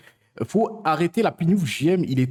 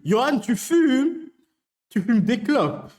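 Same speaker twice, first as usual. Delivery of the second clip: mock shocked; 7 semitones higher, 4 dB louder, slower than usual. Outraged at viewer smoking.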